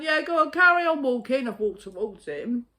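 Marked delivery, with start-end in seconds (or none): 0.0-1.5 very high, loud jeering call; taunting shout. Retold or imitated. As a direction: yell after him down the road. Calling after departing man.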